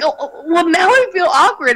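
mildly rattled, retold with a rising pitch; nervous. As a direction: stammer it out in mock awkwardness. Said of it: "pacing nervously".

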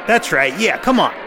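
mock taunts, each one punched out; animated egging-on. Host imitating Larry cheering on a TV fight, animated. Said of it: "Larry's getting quite animated".

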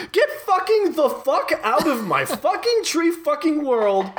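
high squeaky cursing rant; tree blob yelling. Acted. Blob keeps yelling at you, high squeaky cursing. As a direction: sputter the curses like a little monster. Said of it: "continues to yell at you with".

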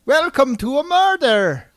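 loud, swooping mock show opening; hammed-up greeting. Host opening the podcast as a joke, loud theatrical greeting.

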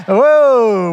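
big pleased exclamation; excited whoa. Real, not acted. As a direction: cheer the room on. Cheering after the recitation.

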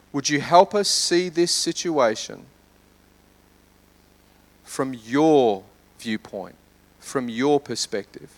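soft, low prayer with long pauses; hushed prayer. Real, not acted. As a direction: pray gently, letting the voice sink. Leads a prayer.

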